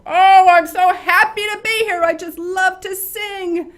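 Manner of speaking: overexcited loud gushing. Shown as a demonstration. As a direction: burst into church far too loud. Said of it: "should you walk in and go".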